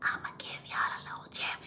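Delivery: hushed, secretive whisper. Real, not acted. Host giving tour followers a secret gem, whispered. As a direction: whisper a tip nobody else should hear. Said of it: "I can't say it too loud".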